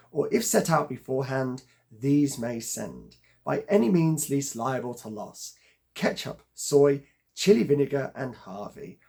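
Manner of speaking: loud, emphatic verse recital; poetry reading. Acted. Reader sharing Byron's advice on Lent food, declaimed emphatically. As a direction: declaim the stanza with punched stresses. Reads a Byron stanza aloud.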